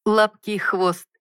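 slow, amused remark; amused chuckle. A narrator's reading. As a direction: let a little laugh through. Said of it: "невольно усмехнулся".

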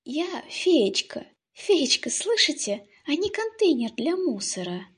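high, loud indignant fairy cry; indignant shout. Acted. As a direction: shout it out, offended.

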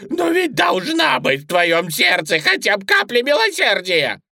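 high, loud wailing plea; anguished rabbit. A narrator's reading. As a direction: beg for mercy in a bunny shriek. Bunny begs for mercy.

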